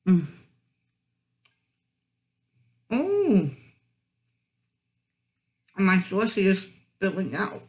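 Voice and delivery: talking while chewing burger; muffled by food in mouth; mouth full, chewing. Real, not acted. Seen 4: Holds burger at mouth, biting and chewing through labelled words.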